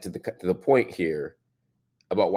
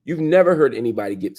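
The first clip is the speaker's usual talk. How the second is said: ordinary talk, nothing marked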